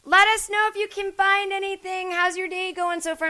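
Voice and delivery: high, loud chirpy cashier call; mock a cashier yelling greetings; screaming cashier. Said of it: "just screaming at whoever walks in".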